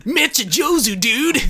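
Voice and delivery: mock slangy foreigner; dated surfer dude. Long-time expat praising Japanese, lightly mocked dude voice.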